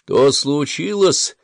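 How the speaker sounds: urgent battlefield demand; shouted question. A narrator's reading. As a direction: shout across to a fellow officer. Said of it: "крикнул он Камрану".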